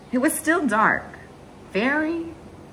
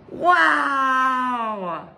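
amazed wow. Real, not acted. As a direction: exclaim in wonder. Reacting to reading the moon page.

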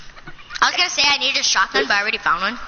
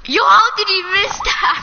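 high, slow, drawn-out disbelief; incredulous outburst; 6 semitones higher, 4 dB louder, slower than usual. Real, not acted. Gamer reacts to a missed shot, incredulous cry.

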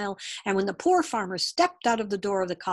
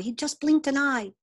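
put-on raised-pitch boyish exclamation; mildly excited boy's voice; crying out; 6 semitones higher, 3 dB louder than usual. A narrator's reading. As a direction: point it out eagerly, like a child. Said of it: "cried the boy".

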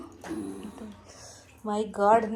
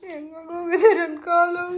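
slow real crying, higher; 4 semitones higher, slower than usual. Real, not acted. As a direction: mourns her leg through tears. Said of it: "ಎಲ್ಲ ಅಳೋದಿಲ್ಲ". (ನಿಧಾನ ಅಳು)

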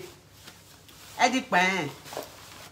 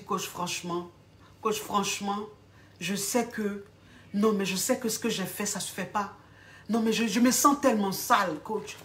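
soft, subdued and halting; ashamed confession; 3 semitones lower, 5 dB quieter than usual. Acted. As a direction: confess quietly, with pauses, as an embarrassed client. Client confesses shame to coach.

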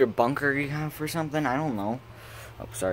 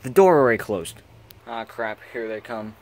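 usual speaking voice

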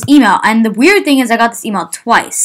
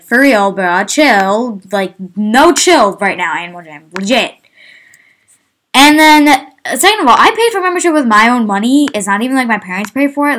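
ordinary talk

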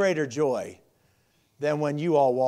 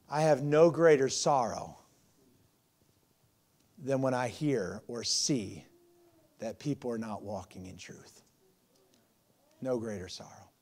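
solemn and quiet; 5 semitones lower, 6 dB quieter than usual. Real, not acted. Pastor admitting sorrow to his church, hushed and grave. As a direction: let the sorrow weigh on each word.

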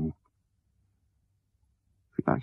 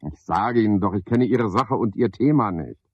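ordinary delivery

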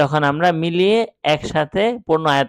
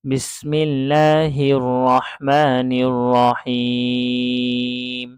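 slow, drawn-out melodic tilawat; Quran recitation; much slower than usual. Shown as a demonstration. Teacher recites Al-Fatiha for his students, slow melodic recitation. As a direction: chant each verse slowly with long held vowels. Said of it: "তো আমি শুরু করছি শুরু থেকে".

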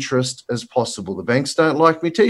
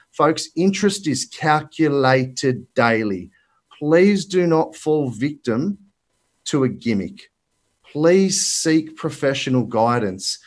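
slow and deliberate; slower than usual. Real, not acted. Slow emphatic warning.

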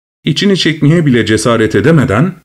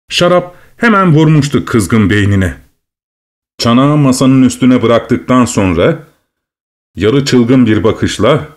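ordinary delivery

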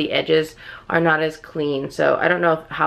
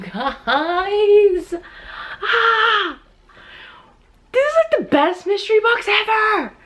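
very high, giddy squealing; overjoyed squeal; slower than usual. Real, not acted. Collector tells viewers it's the best box ever, overjoyed squeal.

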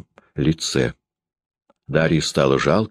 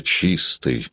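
drawn-out, disdainful word; scornful drawl; 2 semitones lower than usual. Acted. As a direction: stretch it with contempt. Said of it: "пренебрежительно протянула".

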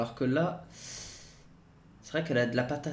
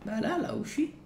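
aigu insistant; 12 semitones higher, 4 dB quieter than usual. Real, not acted. Explique où aller, agacé.

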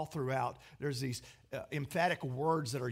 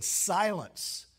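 loud, higher one-word command; commanding rebuke; 4 semitones higher, 6 dB louder than usual. Acted. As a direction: rebuke the storm with sudden force. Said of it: "Jesus rebuked the storm".

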